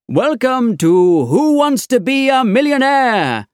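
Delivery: high, slow, loud showman welcome; game show host. Acted. Quiz host welcoming the studio audience, big high showman voice. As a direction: announce it like a TV host.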